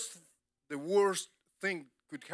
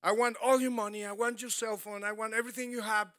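quick, loud robber's demand; a hint of a gunman demanding money; 6 semitones higher, 4 dB louder, faster than usual. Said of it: "this guy came with a gun and tell her".

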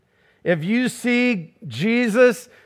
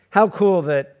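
ordinary delivery

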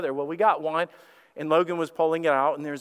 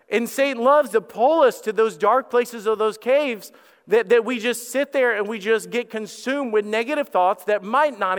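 loud, higher full-force preaching; 5 semitones higher, 5 dB louder than usual. Acted. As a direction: drive the point home to the congregation.

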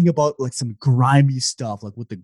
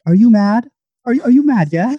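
mock UK menace; 7 semitones higher, 4 dB louder than usual. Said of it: "Why'd you have to say it like that".

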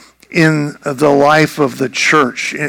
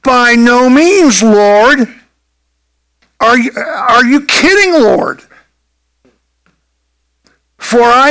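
higher-pitched, disbelieving refusal; incredulous protest; 5 semitones higher than usual. Acted. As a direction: protest to the Lord, stretching the words. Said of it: "Peter says back to the Lord".

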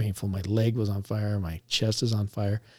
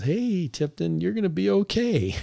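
higher, falsely cheerful comfort; forced reassurance; 9 semitones higher than usual. Retold or imitated. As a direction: fake a bright "you'll be fine". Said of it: "You're the worst actor ever".

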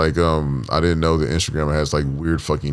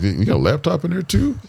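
mock amazement; 8 semitones higher, faster than usual. Man imitating podcast newcomers seeing his suitcase, mock amazed. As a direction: ask it high, as if wowed.

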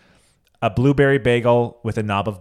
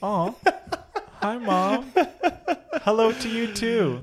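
sweet greeting; 12 semitones higher, slower than usual. Real, not acted. Greeting friend's mom on speaker.

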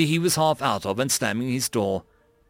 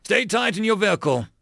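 raised, higher-pitched command; shouted order; 7 semitones higher than usual. A narrator's reading. Sergeant warns her soldier after gunfire, shouting. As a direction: call a warning over gunfire. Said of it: "she shouted".

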